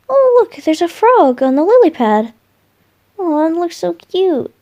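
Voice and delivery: sudden high, loud burst of delight at a frog. Acted. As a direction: squeal with joy at the cute frog.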